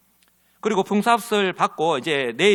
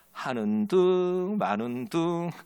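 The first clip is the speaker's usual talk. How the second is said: half-hearted drag; 4 dB quieter, much slower than usual. Acted. Acting out half-hearted work.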